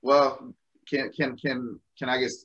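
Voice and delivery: ordinary delivery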